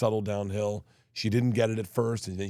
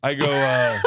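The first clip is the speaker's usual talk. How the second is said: high laughing attempt at a fake European accent; cracking up mid-accent; laughing through a bad accent; 4 semitones higher, 6 dB louder, slower than usual. Real, not acted. Tries his confusing European guy, dissolves laughing. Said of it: "a very confusing European guy".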